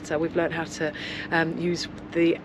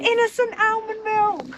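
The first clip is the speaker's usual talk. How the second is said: high thrilled squeal; squealing delight; 4 dB louder, slower than usual. Real, not acted. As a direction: shriek it with joy. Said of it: "So surprised".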